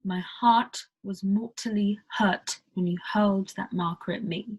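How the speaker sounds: whispered British accent with the T pronounced clearly; crisp British T. Shown as a demonstration. Teacher demos a clear British T to learners. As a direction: hit every T crisply. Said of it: "you definitely pronounce the T".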